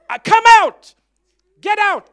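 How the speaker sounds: very loud, high commanding yell; shouting down a small demon; rebuking shout. Retold or imitated. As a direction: cast it out at full volume. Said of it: "because I shouted at the fly".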